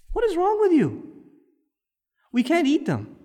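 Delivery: high incredulous cry; exasperated. Real, not acted. Preacher scolding his congregation, incredulous. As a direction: throw the question up in disbelief.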